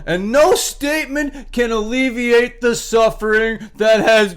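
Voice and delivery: high, loud, slow mock-solemn reading; mocking contempt. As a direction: ham up the band's sorrowful statement to ridicule it. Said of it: "read this last sentence with utter contempt".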